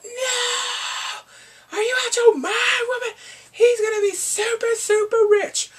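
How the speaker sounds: high, wailing yell of disbelief; outraged shout. Retold or imitated. Fan scolding the woman who dumped him, high outraged shouting. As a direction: shriek at her like an exasperated fan.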